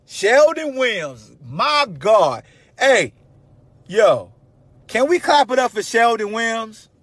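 loud, drawn-out hype for a name; rally the crowd to clap; hype call-out. Real, not acted. Host calling on listeners to applaud Sheldon, loud hype.

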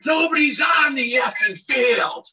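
high, slow exasperated yell; angry shout. Retold or imitated. Catcher running out of the dugout shouting in protest. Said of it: "And I go".

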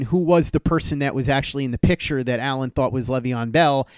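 ordinary talk

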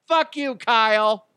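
high, loud comic outburst; mock angry shout. Bride refusing groom Kyle at altar, mock angry shout. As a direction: burst out as the outraged bride.

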